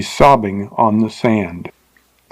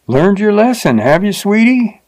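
much higher, mocking jeer; 9 semitones higher than usual. A narrator's reading. Rider jeers at the fallen teacher, mocking. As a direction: taunt her like a crude drifter. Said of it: "he jeered at her".

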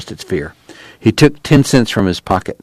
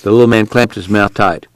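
usual speaking voice

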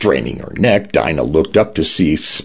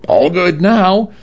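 shouted; 12 semitones higher, slower than usual. A narrator's reading. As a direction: call slowly across the pool. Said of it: "waved a hand bearing a wrench and shouted".

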